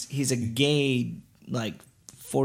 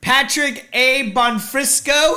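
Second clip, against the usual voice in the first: slow, high-pitched proclamation; hammed-up public announcement; mock loud announcing; 8 semitones higher, 3 dB louder, slower than usual. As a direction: call the name out slowly to a crowd. Acting out shouting in theater.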